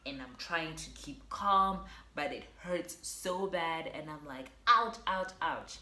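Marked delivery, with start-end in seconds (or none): 4.7-5.8 sharp loud pained ouch, higher; pained yelps of ouch; retold ouch. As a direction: yelp ouch in pain. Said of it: "I am screaming".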